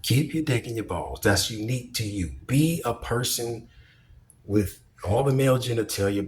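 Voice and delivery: ordinary talk, nothing marked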